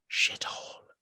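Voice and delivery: whispered Belfast insult, barely audible; under his breath. Retold or imitated. Bus driver muttering at the passenger, whispered under his breath. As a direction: breathe it out so only you can hear. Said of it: "under his breath".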